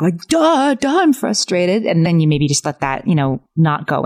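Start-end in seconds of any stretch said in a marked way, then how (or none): 0.3-1.9 high, snotty mock kid retort; mock kid yelling back. Kid yelling back at parent: high mocking retort. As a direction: sneer it like a kid talking back. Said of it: "you might have a kid yell back".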